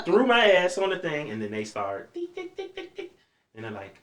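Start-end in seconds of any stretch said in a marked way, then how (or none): 2.1-3.1 high, quiet, clipped ticking sounds; machine ticking. Retold or imitated. Hooked to the machine before surgery, ticking sounds. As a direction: click it out like a monitor.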